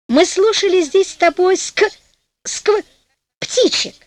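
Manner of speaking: высокий голос, спотыкается и поправляется; запнись на начале слова и замени его; запинка. A narrator's reading. Жена запинается, избегая слова скворцы. (с осторожной запинкой)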